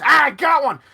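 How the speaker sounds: high, loud triumphant shout; excited acted battle holler; gloating Lord Mayor. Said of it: "you can hear the Lord Mayor goes".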